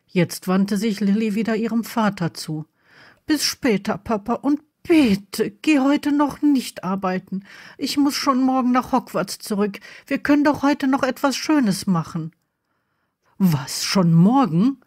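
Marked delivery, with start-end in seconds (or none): 3.3-12.3 put-on higher, imploring young daughter voice; earnest higher-pitched begging; pleading daughter. Voices Lily pleading with father. 13.4-14.8 slow, alarmed question with rising end; taken aback, voice swinging upward; startled. A narrator's reading. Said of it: "fragte Harry erschrocken".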